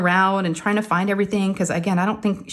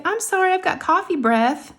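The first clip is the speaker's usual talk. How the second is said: high-pitched imitation of a chatty apology; apologetic office manager; 10 semitones higher than usual. Office manager leaning over patient, apologetic. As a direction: apologize in a lifted, put-on voice. Voicing Carla's casual apology.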